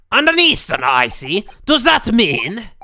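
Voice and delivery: put-on loud booming Scottish accent; loud Scottish governor. Prison governor introducing himself to visitors, loud Scottish accent. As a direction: boom it out as a proud Scottish governor.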